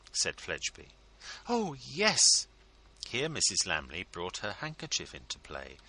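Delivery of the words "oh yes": high, effusive ladylike voice; fawning hostess; gushing society lady. A narrator's reading. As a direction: pour on the flattery, much higher. Mrs Lammle voiced by narrator.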